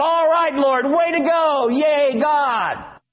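high, loud cheer; shout of joy. Pretend. Congregation cheering God for victory, loud high shout. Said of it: "we want to shout".

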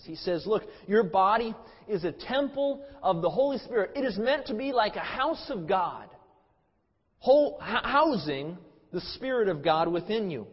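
rising sermon intensity, emphatic and slowed; fervent preaching. Acted. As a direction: preach it hard, pressing each point. Preacher building to full force.

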